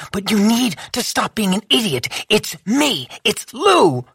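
put-on urgent plea to an old friend. A narrator's reading.

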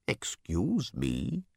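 slow, drawn-out, low bird voice; strange bird creature. A narrator's reading. Bird first addressing Random, slow and strange.

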